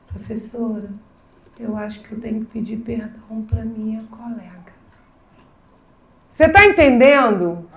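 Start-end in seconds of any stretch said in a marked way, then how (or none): 0.1-4.8 hushed unvoiced confession; student whispering an apology to the teacher; timid whisper. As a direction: whisper it like a shy, ashamed pupil.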